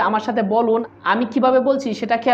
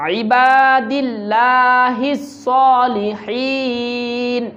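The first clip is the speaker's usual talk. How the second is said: slow recitation; much slower than usual. Shown as a demonstration. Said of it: "আইনটা ভিতর থেকে উচ্চারণ".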